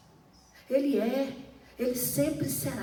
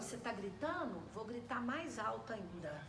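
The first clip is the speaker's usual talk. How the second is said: ordinary delivery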